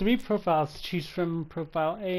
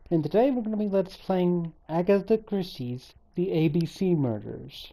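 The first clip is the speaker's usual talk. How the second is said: ordinary talk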